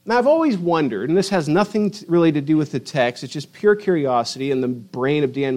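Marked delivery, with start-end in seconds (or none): none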